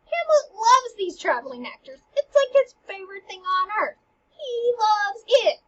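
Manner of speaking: high, loud burst of gushing enthusiasm; pumped up, drawing out the big moments; excited. Retold or imitated. Teacher telling class how Hamlet loves actors, excited. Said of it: "He's excited. He's pumped up".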